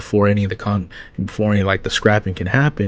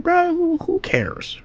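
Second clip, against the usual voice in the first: mocking dismissal; slower than usual.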